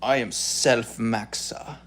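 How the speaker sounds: slow, self-satisfied mock guru voice; hammed-up vain influencer, slow and grand; smug self-maxer impression. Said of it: "goes like this".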